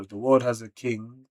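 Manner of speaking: ordinary talk, nothing marked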